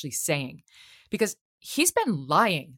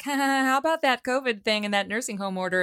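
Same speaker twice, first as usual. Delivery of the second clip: higher, with a fake chuckle, brushing the question off; mock breezy laugh; 6 semitones higher than usual. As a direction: guest mocks a TV host's giggly question. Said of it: "she was like".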